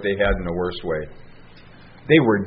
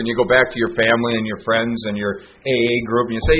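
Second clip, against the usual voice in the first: usual speaking voice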